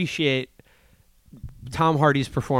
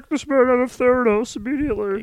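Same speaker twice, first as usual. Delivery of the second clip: higher, loud imperious order; mock Holmes; 8 semitones higher, 5 dB louder than usual. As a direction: command like an affronted CEO. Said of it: "she's like".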